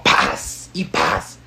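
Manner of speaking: sharp whispered pow sound; imitated ball smack.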